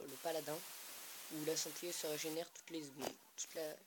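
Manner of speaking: ordinary talk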